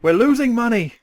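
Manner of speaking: loud, high-pitched executive panic; play-acted money panic at full volume; mock panicked shout. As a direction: yell it like a hammed-up desperate boss. Joins in as panicked Sega boss.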